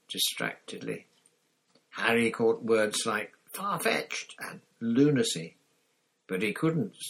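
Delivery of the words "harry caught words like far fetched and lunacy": ordinary delivery, nothing marked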